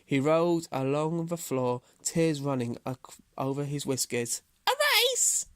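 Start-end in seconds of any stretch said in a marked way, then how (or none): 4.7-5.5 very high, incredulous squeak; gasping hare. A narrator's reading. Hare hears of the race, gasping in high disbelief. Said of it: "he gasped".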